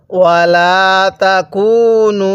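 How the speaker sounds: ওয়ালা তাকুনু slow, তাকুনু held three alif; slow tajweed recitation; Quranic recitation. Shown as a demonstration. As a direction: chant ওয়ালা তাকুনু with the long stretch. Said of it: "কিভাবে পড়ব?".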